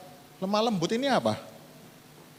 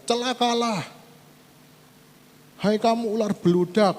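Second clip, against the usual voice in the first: raised, louder preacher's cry of woe; quoted woe cry; 7 semitones higher, 3 dB louder than usual. Retold or imitated. Preacher voicing Jesus crying woe at the crowd, raised and loud. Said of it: "Tuhan Yesus tuh teriak-teriak".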